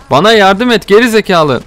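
high, loud frustrated shout; furious shout for help; angry yell. Real, not acted. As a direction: snap at the useless ally. Yelling at useless NPC.

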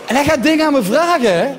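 ordinary talk, nothing marked

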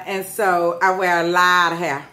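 usual speaking voice

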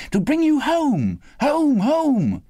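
high, ringing, joyful repetition; gleeful little girl. A narrator's reading. Little sister tells brother he's leaving school, gleeful. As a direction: sing out the news in childish delight. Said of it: "brimful of glee".

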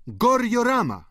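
high, loud capping word; triumphant punchline. A narrator's reading. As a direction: toss it out as a gleeful topper. Painter caps the joke.

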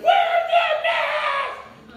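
very high, very slow, hammed-up scream; yelling. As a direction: stretch each word into a piercing yell. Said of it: "and yelled at him".

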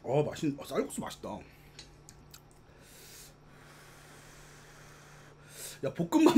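chewing while talking; speaking between bites; mouth full of noodles. Real, not acted. Seen 2: Slurped noodles just before; head down, mouth working while speaking.

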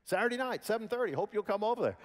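high, eager, proud reply; excited invitation. Retold or imitated. Choir member telling the waiter about Carnegie Hall, excited and high. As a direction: invite them with bright excitement. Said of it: "they're going".

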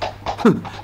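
a short quiet laugh through the nose; soft amused chuckle. Acted. Man amused by the pony, soft chuckle. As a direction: chuckle at the scruffy little pony.

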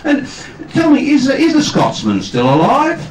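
high, loud, drawn-out attempt at a New Zealand interviewer; New Zealand accent. Radio interviewer asks after the cast, imitated New Zealand accent. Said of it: "whether I can do the accent".

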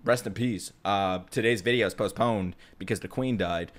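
low, soft token condolence; mock condolence announcement. Refusing to virtue signal to viewers, mock solemn announcement. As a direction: go through a dutiful notice, low and subdued. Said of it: "just telling you".

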